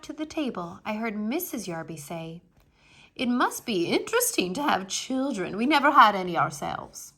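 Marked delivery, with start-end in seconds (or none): 3.1-7.1 prim lady. Acted. Prim Missus Yarby.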